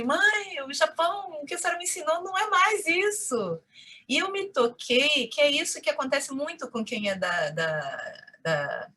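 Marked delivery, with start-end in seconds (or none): 0.0-3.6 high-pitched retold complaint to mom; mock grumble, pitched well up; complaining. Daughter phoning her mother about the Japan she was taught, complaining. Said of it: "Eu telefonava pra minha mãe toda semana reclamando".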